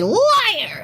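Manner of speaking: high accusing cry; mock accusation. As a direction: call it out like an accuser. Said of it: "come along later and say".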